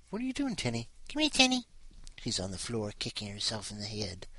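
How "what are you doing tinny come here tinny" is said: high-pitched coo; pet talk. Real, not acted. Owner calling Tinny the cat over, cooing high.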